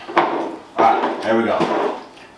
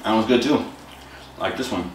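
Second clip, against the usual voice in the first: chewing while talking; talking between bites; mouth full of cereal; 5 dB quieter, faster than usual. Real, not acted. Seen 2: Holds cereal bowl and spoon, jaw working while talking between bites.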